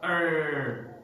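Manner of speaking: "er" held long with a strong R; drawn-out American "er"; stressed R. Shown as a demonstration. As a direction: stretch the R in "er". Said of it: "they pronounce it".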